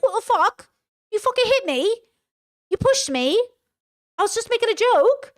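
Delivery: mock him as a shrill, indignant victim; mock outraged boyfriend. Retelling the boyfriend's reaction to being pushed, high mocking outrage. Voicing the boyfriend's outburst in story.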